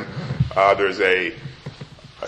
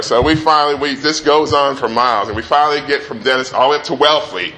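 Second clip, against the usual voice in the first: usual speaking voice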